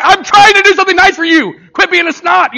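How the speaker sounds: loud, high-pitched fed-up dad rant; hammed-up parental outburst; exasperated dad. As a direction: shout it like a parent at wit's end. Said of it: "Any dad's been there like".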